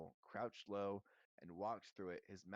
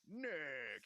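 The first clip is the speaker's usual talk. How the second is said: loud, higher hearty hail; roar; 5 semitones higher, 5 dB louder than usual. A narrator's reading. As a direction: roar the name in greeting. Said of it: "he roared".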